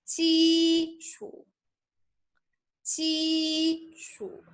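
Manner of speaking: 基础 with both syllables high and level, first tone; Mandarin 基础. Shown as a demonstration. 老师示范基础，同样的声调. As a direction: keep 基础 high and flat like 薪水. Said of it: "same tone".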